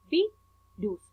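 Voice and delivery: "virus" split in two, vi, rus; syllable by syllable. Shown as a demonstration. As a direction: say "virus" with a gap between "vi" and "rus". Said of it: "tienen dos sílabas". ("virus" separado en dos sílabas)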